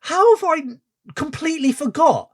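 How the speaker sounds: very high, squealed astonishment; real high-pitched incredulity; amazed disbelief. Real, not acted. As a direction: stop everything, stunned and delighted. Stunned by rapper's English accent bit.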